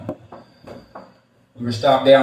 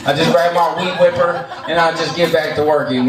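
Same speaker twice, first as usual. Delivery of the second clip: ordinary talk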